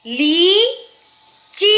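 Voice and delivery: "लीची" with long ली, pause, long ची; slow dictation of "लीची"; both vowels long. Shown as a demonstration. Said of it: "च में भी बड़ी आवाज़".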